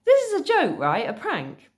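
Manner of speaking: high, loud incredulous schoolgirl; disbelieving. Acted. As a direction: insist in high-pitched disbelief. Schoolgirl suspects a prank.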